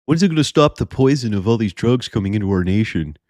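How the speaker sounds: mock critic complaint; mocking naysayers.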